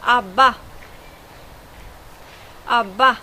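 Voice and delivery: ABBA pronounced the Swedish way. Shown as a demonstration. Teacher showing viewers the name ABBA, Swedish pronunciation. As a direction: model ABBA in Swedish, word on its own. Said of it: "words that I should, uh, pronounce".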